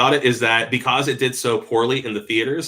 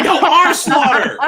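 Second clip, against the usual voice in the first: movie quote; 6 dB louder, slower than usual. Retold or imitated. Quoting movie line, laughing.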